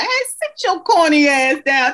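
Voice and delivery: mock-exasperated quote of husband; husband's put-down. Husband telling his wife to sit down, hammed-up put-down. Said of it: "he was like".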